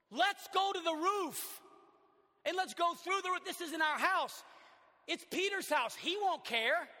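high, loud, slow eager plotting voice; scheming friend. Acted. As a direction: sell the crazy plan with glee. Friend pitches roof idea.

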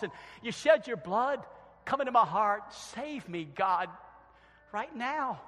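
loud, earnest prayer voice; modelling a prayer for the congregation; spoken prayer. Acted. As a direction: pray it aloud for them to repeat. Leads the sinner's prayer.

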